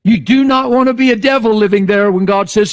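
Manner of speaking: loud, high roar; full-force preaching. Real, not acted. Preacher warning about demons, loud and high.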